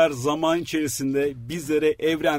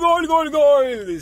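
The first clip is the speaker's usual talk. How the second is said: goal scream; 11 semitones higher, 6 dB louder, much slower than usual. Pretend. Goal celebration after mock commentary.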